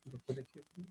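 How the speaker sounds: faint, broken remote audio; radio breaking up. Real, not acted. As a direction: voice dropping out on a bad call line. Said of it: "We cannot hear you".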